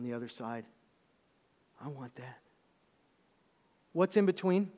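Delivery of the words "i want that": soft whisper; whispered longing. Retold or imitated. Preacher voicing the heart's hidden wish, whispered. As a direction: breathe it as a secret wish.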